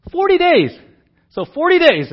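"Forty days" punched high and loud; stress on forty. Real, not acted. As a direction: hammer "Forty" to stress the length.

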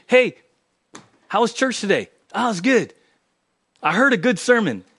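breezy small talk after service; a hint of casual congregant banter; casual church chat.

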